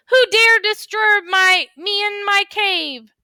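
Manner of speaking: high, loud, slow dragon roar. Acted. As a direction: bellow it like a woken dragon. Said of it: "make sure your voice sounds exciting too".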